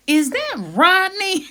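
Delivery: high, loud, drawn-out squeal of disbelief; astonished recognition. Retold or imitated. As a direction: act out stunned recognition, hugely hammed-up. Recognises body running off.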